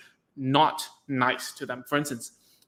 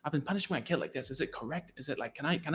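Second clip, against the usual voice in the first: softer, hesitant parent voice; worried parent; 11 dB quieter, faster than usual. Retold or imitated. A parent asking a therapist if their punishment is okay. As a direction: ask quietly as an unsure parent. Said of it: "parents will ask".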